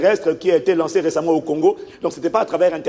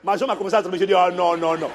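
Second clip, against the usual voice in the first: normal talking voice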